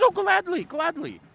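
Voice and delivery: very high, delighted agreement; hearty welcome. Acted. Camp leader granting the bard a night's stay, hearty. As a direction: leap up in pitch with glad assent.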